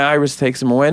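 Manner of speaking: ordinary talk, nothing marked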